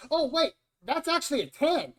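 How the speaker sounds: high, loud sudden excitement; excited. Real, not acted. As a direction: burst out in delight. GM excited at dice roll.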